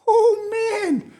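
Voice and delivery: laughing as the voice squeaks up high. Real, not acted. Picturing leaders breathing, laughing as the voice shoots up. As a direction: break into laughter mid-phrase. Laughs at his own point.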